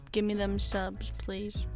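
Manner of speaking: ordinary delivery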